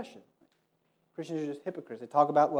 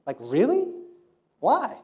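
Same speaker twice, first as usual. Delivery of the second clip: incredulous; 9 semitones higher, 5 dB louder than usual. Retold or imitated. Friend questioning in disbelief.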